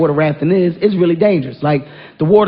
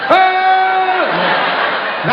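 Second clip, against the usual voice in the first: much higher whooping shout of Ah!; excited yell; 12 semitones higher than usual. Acted. Leaning off the raft front at the waterfall, whooping yell. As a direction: whoop it like riding the front of a ship.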